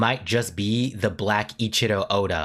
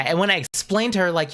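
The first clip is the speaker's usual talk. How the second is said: normal talking voice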